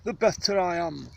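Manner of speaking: lofty Shakespearean luvvie; Sir John Gielgud. Retold or imitated. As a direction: declaim it like a grand stage knight. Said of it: "As Sir John Gielgud would say".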